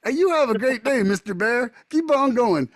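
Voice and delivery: high, friendly goodbye to a bear; cheery send-off to a bear. Retold or imitated. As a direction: wave the bear off brightly, like a kind neighbor. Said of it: "I'm like, I'm like".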